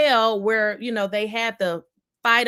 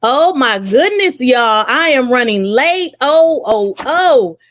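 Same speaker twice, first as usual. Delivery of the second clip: loud, flustered late arrival; big loud announcement of running late; flustered excitement; 11 dB louder, slower than usual. Real, not acted. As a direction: burst in exclaiming, much louder than usual. Rushing in late to livestream.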